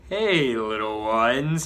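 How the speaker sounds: loud, slow, drawn-out villain greeting; bellowing villain. Acted. Villain arriving to taunt the kids, slow loud bellow. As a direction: drawl it out like a gloating brute. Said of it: "he bellows".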